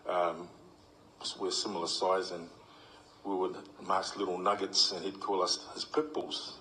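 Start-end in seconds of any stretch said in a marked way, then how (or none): none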